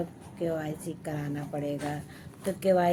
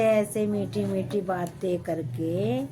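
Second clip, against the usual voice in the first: ordinary talk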